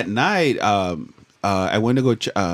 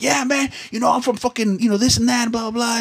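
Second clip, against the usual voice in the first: much higher mock old man rambling; 12 semitones higher than usual. Old man rambling to the runner about himself, high mock voice. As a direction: rattle on like a chatty old guy. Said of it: "He's like".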